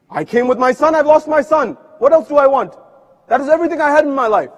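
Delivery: mother's anguished protest to the family; grief-stricken outcry. Acted. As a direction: pour out the loss in a rush. Mother refuses again in Karbala.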